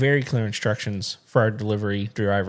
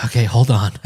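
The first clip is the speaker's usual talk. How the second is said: meek driver; 5 dB louder than usual. Retold or imitated. Driver agrees to fetch package.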